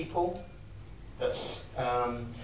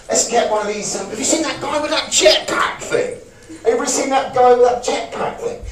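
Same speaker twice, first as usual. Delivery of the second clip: much louder, much higher, thrilled; exhilaration demo; 7 semitones higher, 10 dB louder than usual. Shown as a demonstration. Acting out exhilaration for the room, loud and thrilled. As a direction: burst out in amazed excitement.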